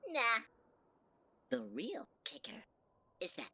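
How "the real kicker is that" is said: whispering. Acted. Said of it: "unvoiced aside".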